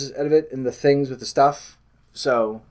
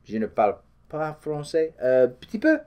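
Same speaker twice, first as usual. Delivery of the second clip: bad French accent; 3 dB quieter than usual. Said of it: "it's a horrible accent".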